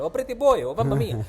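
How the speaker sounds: high, loud sing-out in a mock Italian accent; exaggerated Italian accent. Women calling to him at the brothel, hammed-up Italian accent. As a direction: ham up the most Italian accent possible. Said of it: "in, in the t-, most Italian accent".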